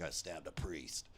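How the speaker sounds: low, slow convict confession; lightly acted prison tough, low and slow; voiced inmate.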